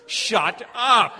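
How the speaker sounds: full-volume, high-pitched shout; exploding dad's shout; furious yell. Retold or imitated. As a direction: yell at the top of your lungs. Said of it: "I yelled at the top of my lungs".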